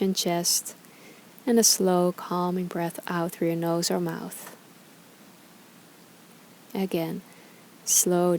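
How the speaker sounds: calm, unhurried meditation guide with long pauses; guided meditation. Acted. Yoga teacher guiding followers through gratitude reflection, calm meditation voice. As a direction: ease listeners through each breath, leaving space between phrases.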